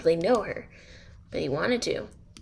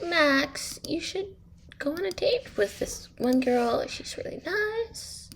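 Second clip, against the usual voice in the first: higher, coaxing mother voice; urging mom; 7 semitones higher than usual. Acted. Mom pushes Max to date a nice girl, higher coaxing voice. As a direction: sweetly push him toward the girl.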